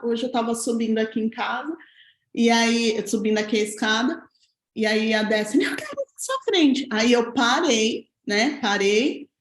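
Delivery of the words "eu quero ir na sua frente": put-on child voice. Child's line voiced by mother.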